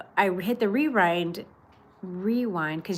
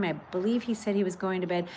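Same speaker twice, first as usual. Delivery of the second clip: ordinary talk, nothing marked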